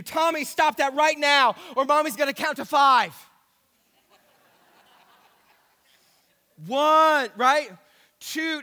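loud, stern count-to-five warning; exasperated mom counting down; threatening mom. Acted. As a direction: warn him you're counting.

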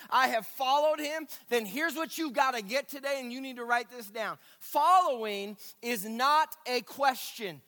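full-force preaching. Acted. Preacher urging born-again believers, loud and high. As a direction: drive the point home to the congregation.

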